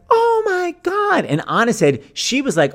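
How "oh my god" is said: very high, slow, louder crying oh my God; hammed-up sobbing oh my God; tearful shock. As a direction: wail it out in sympathy. Shocked sympathy again.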